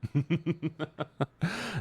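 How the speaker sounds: soft chuckle rising in pitch; rapid quiet giggle; laugh. Real, not acted. Laughs between remarks.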